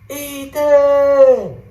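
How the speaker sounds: high, loud frantic call into the dark; mom yelling a name. Retold or imitated. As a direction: call out for a lost child at full voice. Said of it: "she's just yelling".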